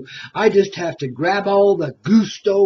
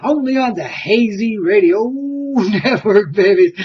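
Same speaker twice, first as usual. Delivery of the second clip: hyped-up radio host intro; 2 semitones higher, 3 dB louder than usual. Acted. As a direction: sell it like a late-night DJ.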